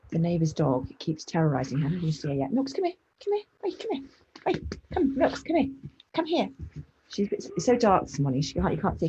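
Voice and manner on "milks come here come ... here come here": high-pitched calls to a dog; real high dog-calling voice. Real, not acted. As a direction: coax the dog over in a high, insistent voice.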